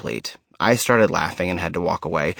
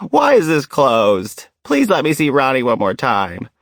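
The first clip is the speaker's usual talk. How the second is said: retold shouting; 5 semitones higher, 5 dB louder than usual. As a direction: demand to open the casket, hammed up. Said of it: "She began shouting".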